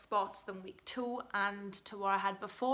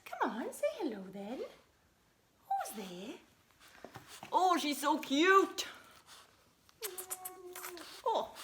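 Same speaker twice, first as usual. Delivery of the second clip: dog voice; 9 semitones higher than usual. Real, not acted. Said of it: "That's my dog voice".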